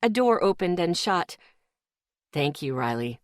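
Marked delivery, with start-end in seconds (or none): 2.3-3.2 a sugary thank-you; sweet. A narrator's reading. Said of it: "Isaac's voice was sweet now".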